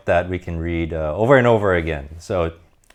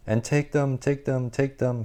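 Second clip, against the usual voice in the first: higher and much softer, a far-off echo; cave echo; 7 semitones higher, 4 dB quieter than usual. Acted. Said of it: "came back the welcome sound".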